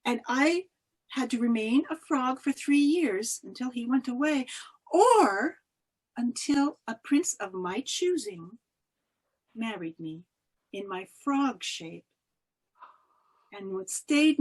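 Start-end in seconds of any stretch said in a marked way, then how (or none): none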